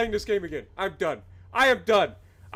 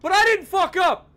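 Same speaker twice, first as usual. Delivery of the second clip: louder, higher outburst that he didn't mess up; angry shout; 6 semitones higher, 7 dB louder than usual. Real, not acted. Streamer to chat blaming the game, angry shout. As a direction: punch it out, indignant.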